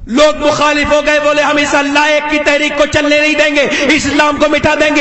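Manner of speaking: steady, projected sermon narration; insistent pulpit delivery; forceful preaching. Real, not acted. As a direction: tell it loudly to a whole congregation.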